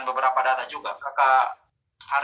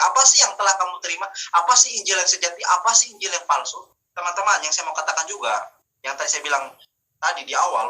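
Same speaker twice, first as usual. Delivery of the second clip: normal talking voice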